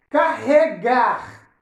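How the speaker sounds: "Carregar." loud and slow, each syllable marked; slow, emphatic syllables. Shown as a demonstration. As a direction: stretch out car, re, gar in "Carregar.". Teacher models word to be divided. ("Carregar." devagar, marcando cada sílaba)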